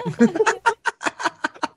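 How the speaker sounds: a very high burst of laughter. Real, not acted. Laughing at how thin the walls are.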